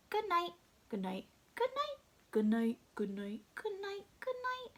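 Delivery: high little animal voices, one after another; chorus of animals. Acted. Reader voices all the zoo animals saying good night, high small voices. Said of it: "those were all the animals saying good night".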